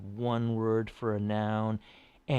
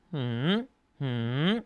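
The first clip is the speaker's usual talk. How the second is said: rising hmm like a question; questioning hum; 3 semitones higher than usual. Shown as a demonstration. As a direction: hum with the pitch sliding upward. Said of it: "It sounds like a question".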